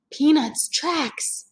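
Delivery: put-on high, slow little girl; Annie's voice. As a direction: Annie notices the tracks. Annie voiced in read-aloud.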